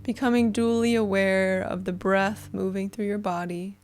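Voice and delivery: soothing guide talking listeners into relaxation; guided meditation. Acted. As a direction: ease them gently into the breath.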